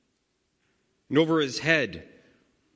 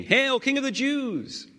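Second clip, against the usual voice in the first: high, drawn-out jeering salute; mock hail; slower than usual. Soldiers jeering at the crucified king, in a high mocking salute.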